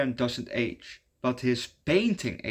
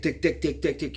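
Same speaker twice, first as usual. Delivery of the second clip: quick, higher-pitched tick tick sounds; mimicked ticking; 4 semitones higher than usual. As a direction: tap out the ticking noise with your voice. Imitating stick against prison wall.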